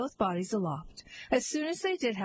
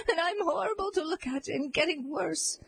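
sobbing; 7 semitones higher than usual. A narrator's reading. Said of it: "sobbed the disguised queen".

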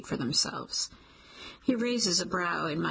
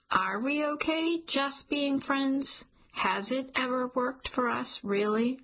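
slow, higher hopeful question; 6 semitones higher than usual. Acted. Cliff asks about just friends.

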